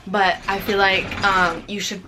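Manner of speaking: ordinary delivery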